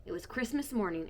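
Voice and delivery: usual speaking voice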